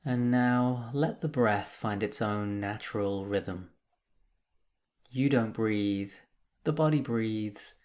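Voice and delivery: calm, measured meditation guide with long pauses; guided meditation. Acted. Meditation teacher guiding listeners through breath and body, calm and unhurried. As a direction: lead them gently into stillness, leaving space between phrases.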